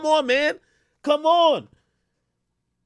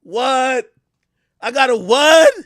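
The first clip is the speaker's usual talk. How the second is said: rising louder in disbelief; what, say that again; mock disbelief; 5 dB louder, much slower than usual. Retold disbelief.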